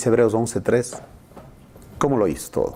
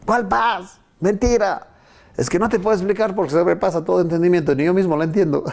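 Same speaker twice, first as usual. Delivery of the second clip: disbelieving then bewildered; 8 semitones higher than usual. Retold or imitated. Dialogue skeptic and believer.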